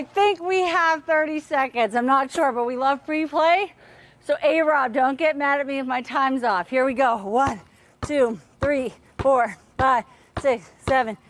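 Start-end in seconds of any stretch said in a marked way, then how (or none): none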